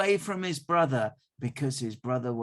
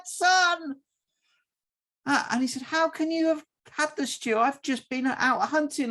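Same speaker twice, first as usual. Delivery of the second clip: shocked confusion; 10 semitones higher, 5 dB louder than usual. Acted. Confused father and son arguing.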